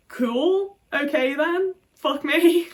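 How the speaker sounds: high, loud incredulous sarcasm; sarcastic disbelief. Real, not acted. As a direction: throw it back in mock acceptance. Sarcastic reaction to mum.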